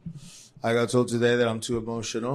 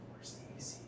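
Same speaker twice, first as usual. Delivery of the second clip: whisper; 28 dB quieter than usual. Real, not acted. Whispered aside off mic.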